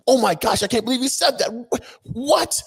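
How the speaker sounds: high, incredulous gasping mock disbelief; mock shocked crowd. Vlogger mocking the shocked public, high mock disbelief. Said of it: "everybody's like".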